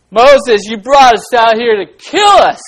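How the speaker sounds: loud, high, hammed-up complaint; grumbling accusation. Israelites blame Moses in a children's Bible story, loud mock outrage. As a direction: shout the blame like an aggrieved crowd. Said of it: "Is that a good attitude?".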